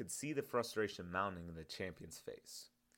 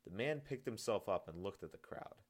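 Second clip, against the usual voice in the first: plain reading voice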